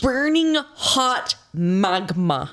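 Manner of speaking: drawn out slowly. Real, not acted. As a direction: stretch it out for effect. Exaggerating the heat.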